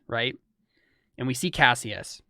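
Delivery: ordinary delivery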